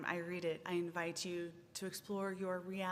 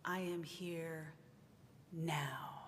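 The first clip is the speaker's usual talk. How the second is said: "I am here" slow and soft, then "now" whispered apart; stress on now; 3 semitones lower, 6 dB quieter, slower than usual. Shown as a demonstration. Minister reading to congregation, "now" whispered alone. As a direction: save the weight for a hushed "now". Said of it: "I will put the emphasis on a different word".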